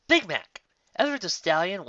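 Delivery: announced title. A narrator's reading. Title shouted.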